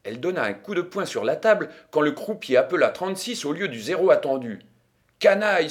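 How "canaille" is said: loud, high outburst of anger; angry exclamation. A narrator's reading. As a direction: cry out the insult, beside yourself. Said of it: "s'écria-t-elle".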